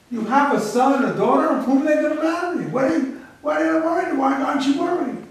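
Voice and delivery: retold puzzled interrogation; insistent questioning.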